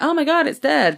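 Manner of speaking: high mock gasp of shock; high mock "oh my God". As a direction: play the crowd's shock lightly. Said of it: "everyone was like".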